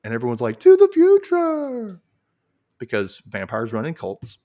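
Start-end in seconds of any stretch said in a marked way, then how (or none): 0.6-2.0 a slow, much louder and higher cheering toast; crowd toast. Acted. Vampires answering their master's toast, in a loud slow cheer. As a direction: raise the glass and bellow it like a cult crowd.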